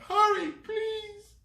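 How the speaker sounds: high, slow loud pleading; frightened plea. Acted. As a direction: beg them to hurry, scared. Sylvie pleading.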